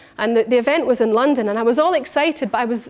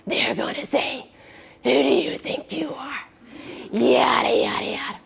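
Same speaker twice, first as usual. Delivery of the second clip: whispered inner critic; 4 dB quieter than usual. Retold or imitated. Woman whispering her inner critic's taunts to herself. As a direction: breathe it like a mean little voice in your head.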